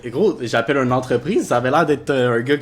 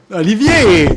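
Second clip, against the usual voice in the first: calling out; 8 semitones higher, 12 dB louder, slower than usual. Real, not acted. Calls out friend's name.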